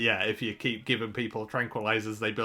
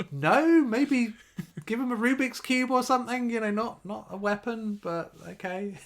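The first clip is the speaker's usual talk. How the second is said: much higher, squeaky-pitched mock disbelief; incredulous objection; 12 semitones higher, 3 dB louder than usual. Co-host mocking the aliens' choice of a gun, high-pitched incredulity. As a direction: push the voice right up in amused protest.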